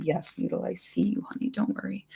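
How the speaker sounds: soft pet talk to Noodle; tender to a pet. Real, not acted. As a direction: hush and reassure your little animal. Reassuring her pet mid-reading.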